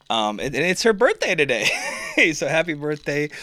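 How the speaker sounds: loud, high, cracking up; laughing announcement. Real, not acted. Storyteller announcing the ex's birthday, real laughter breaking in. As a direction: burst out with it, laughing at the absurdity.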